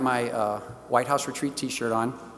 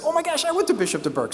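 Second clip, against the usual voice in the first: much higher and fast, thrilled recognition; excited exclamation; 12 semitones higher, faster than usual. Retold or imitated. Telling the patient he went to the same school, thrilled. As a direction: blurt it out, delighted at the coincidence. Retelling his own excited reply.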